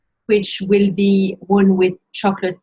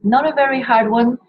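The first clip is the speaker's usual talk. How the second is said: ordinary talk, nothing marked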